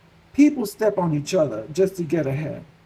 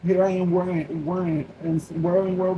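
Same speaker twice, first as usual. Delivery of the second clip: ordinary talk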